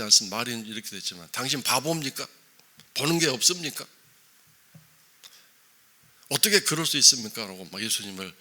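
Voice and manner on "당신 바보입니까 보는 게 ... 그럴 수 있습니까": loud, higher, indignant scolding; Martha scolding Jesus. Retold or imitated. Martha berates Jesus as if he were a fool, loud angry rebuke. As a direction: demand how he could be so blind. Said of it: "야단치고 있는 거죠".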